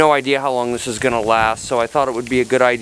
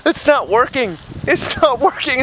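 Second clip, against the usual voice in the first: high panicked cold gasps; gasping in icy wind; 11 semitones higher than usual. Acted. Freezing in snow, panicking.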